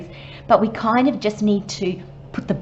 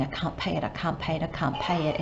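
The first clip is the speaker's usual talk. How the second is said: softer, anxious repeated mutter; fretting quietly over a bill you can't pay; worried muttering; 3 semitones lower, 7 dB quieter, faster than usual. Retold or imitated. Person fretting over an unpayable bill, mild anxious mutter.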